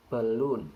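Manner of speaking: "balloon" with the stress on the second syllable, loon. Shown as a demonstration. Teacher demonstrating word stress to viewers, stressing the second syllable. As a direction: lean on the end of "balloon", not the start. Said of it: "The stress occurs in the second syllable".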